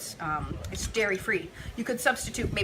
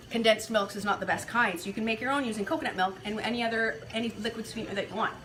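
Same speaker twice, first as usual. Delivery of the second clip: usual speaking voice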